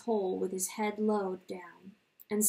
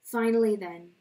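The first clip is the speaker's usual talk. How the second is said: plain reading voice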